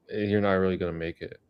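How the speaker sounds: usual speaking voice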